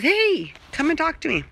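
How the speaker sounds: a hint of cat voice; voicing the cat. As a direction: speak for the cat. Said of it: "She says".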